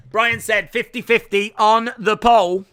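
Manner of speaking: usual speaking voice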